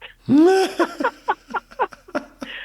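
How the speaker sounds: a loud, real laugh at the punchline; cracking up loudly; hearty laugh. Real, not acted. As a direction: burst out in a big high laugh. Laughs at his own story.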